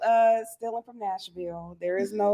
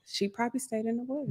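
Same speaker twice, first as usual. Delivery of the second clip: much softer, slightly raised pitch; tentative aside; 4 semitones higher, 10 dB quieter than usual. Real, not acted. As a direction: offer the guess quietly. First, murmured take under others' talk.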